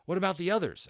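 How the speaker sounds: urgent question. A narrator's reading. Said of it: "asked Patrick".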